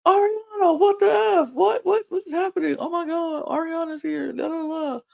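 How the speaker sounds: very high-pitched imitation of a startled crowd; mock excited squeal. Islanders react to Ariana's surprise return, mock excited squealing. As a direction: squeal it like a stunned group, fading in volume.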